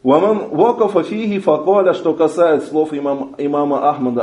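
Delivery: usual speaking voice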